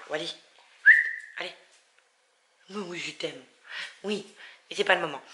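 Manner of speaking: very soft, tender words to the dog Wally; doux au chien. Real, not acted. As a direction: reassure the dog gently, almost under her breath. Parle doucement à son chien. (parle tout bas et tendrement à son chien Wally)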